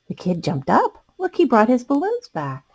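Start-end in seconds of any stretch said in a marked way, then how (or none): none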